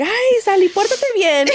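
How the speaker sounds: high, playful Spanish telling-off; mom scolding the dog. Retold or imitated. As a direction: imitate a mom chiding her dog. Mom's mock scolding of the dog.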